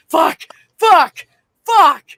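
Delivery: high, loud panicked cursing; panicked swearing. Retold or imitated. Husband facing the huge spider, panicked high shout. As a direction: yell it out in sheer alarm.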